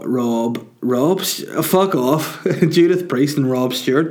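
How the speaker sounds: usual speaking voice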